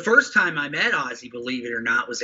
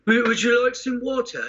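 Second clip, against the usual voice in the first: put-on English accent; 7 semitones higher than usual. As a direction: kindly offer in a light English accent, pitched higher. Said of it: "he's got that English accent".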